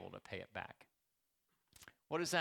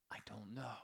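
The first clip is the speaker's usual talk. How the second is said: whisper; 5 semitones lower, 10 dB quieter than usual. Real, not acted. Aside during sermon.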